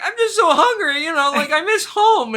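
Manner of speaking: high, loud mock whining; hammed-up blubbering with laughter in it; mock crying. Hungry contestant crying on day one, mock crying. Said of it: "she starts crying. She's like".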